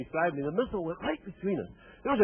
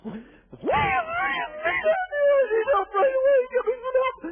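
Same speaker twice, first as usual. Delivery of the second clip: squeaky, panicked, much higher voice; blurting for help in a high panic; 5 dB louder than usual. Retold or imitated. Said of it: "my voice was up about three octaves".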